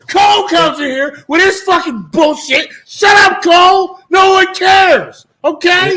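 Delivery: high, loud, furious yelling; angry shout. Real, not acted. Ranting at Cole.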